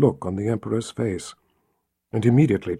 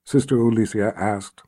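ordinary delivery

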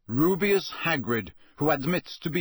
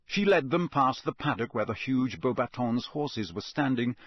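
ordinary delivery

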